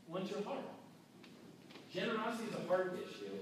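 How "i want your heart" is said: softer, repeated to land it; preacher softly repeating Jesus' words to the congregation; quiet echo. Real, not acted. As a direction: let it sink in quietly.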